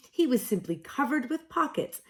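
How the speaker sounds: ordinary delivery, nothing marked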